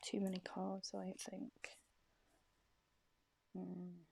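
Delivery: voiceless whisper to herself; whispered aside. Real, not acted. Shuffling a thick deck, a whispered aside. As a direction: mutter it under your breath.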